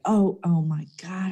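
whispered dismay. Retold or imitated. Seeing traffic ahead, whispered dismay. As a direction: breathe it out under your breath. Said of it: "I was like".

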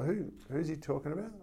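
softly retold query, lifted in pitch; curious female barber. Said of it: "she was like".

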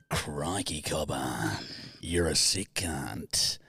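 low mock Australian bloke; lightly voiced Aussie in a sexy voice; hint of a slow, low Australian drawl; Australian accent. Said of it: "an Australian going to America".

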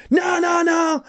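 high wailing refusal; retold panicked protest. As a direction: imitate a man backing out in alarm. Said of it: "And he went".

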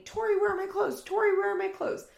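very high, loud mock shriek; retold screaming. Roommate demanding her clothes back, mock screaming. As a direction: imitate a frantic demand, repeated fast. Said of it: "Janelle's screaming".

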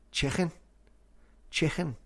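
"chicken" with a throaty ch like Spanish j, CHI-khen; raspy Scouse "chicken", the k like ejercicio; "chicken" con la jota de "ejercicio". Shown as a demonstration. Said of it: "the same sound as Spanish".

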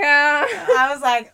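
high and loud, very drawn out; gushing over a new word; mock excited. Excited retelling of own reaction.

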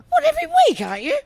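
incredulous. Retold or imitated. Mocking the young comic.

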